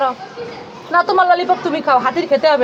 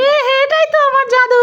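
put-on higher, eager little child; childlike character voice; 9 semitones higher than usual. Little character tells mummy about her magic trick, eagerly.

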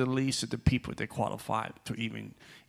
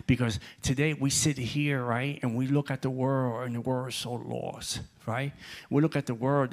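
ordinary talk

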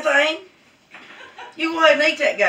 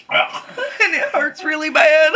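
normal talking voice

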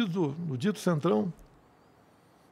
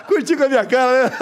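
amused, laughing words; 7 semitones higher, 4 dB louder, faster than usual. Real, not acted. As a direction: say it while cracking up. Laughs at the photo joke.

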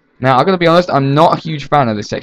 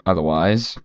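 ordinary delivery

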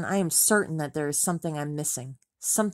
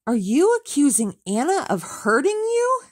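higher, louder furious yell; 10 semitones higher, 6 dB louder than usual. Acted. Tom yells at Rachel on the phone, furious. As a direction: yell it at her in disbelief. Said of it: "He's yelling now".

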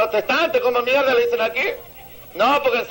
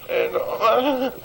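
usual speaking voice

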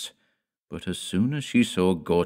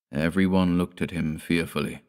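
usual speaking voice

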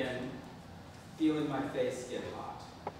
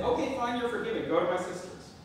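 higher, brushing-off sarcastic reply; 8 semitones higher, 4 dB louder than usual. Retold or imitated. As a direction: toss off the forgiveness sarcastically. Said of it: "less sarcastic tone".

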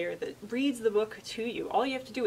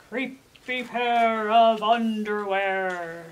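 slow, spooky title with a louder swell; dramatic title read; 6 dB louder, much slower than usual. Shown as a demonstration. Librarian opens the story for young viewers, slow and spooky. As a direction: stretch each word like a creepy reveal. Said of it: "It is dramatic reading time".

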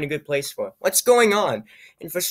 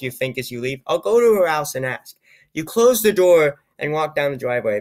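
usual speaking voice

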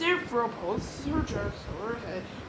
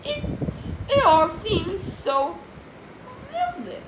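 high, louder character voice; Miss Anthrope dreaming; 11 semitones higher, 7 dB louder than usual. Acted. Miss Anthrope deciding she is dreaming, in a high voice. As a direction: speak high as the dreaming woman.

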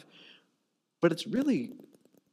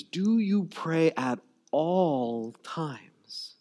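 slow searching question; much slower than usual. Real, not acted. Preacher addresses congregation.

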